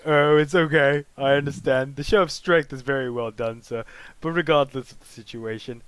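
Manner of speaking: condescending inmate talking down; prison inmate voice. Acted. Inmate to new prisoner, loud and condescending.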